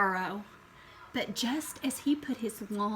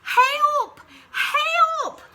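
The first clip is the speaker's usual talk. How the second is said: very high, loud cries for help; an elephant shrieking in fright; frightened trumpet; 10 dB louder than usual. Acted. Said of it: "trumpeted Elephant".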